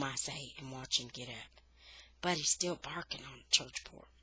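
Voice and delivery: usual speaking voice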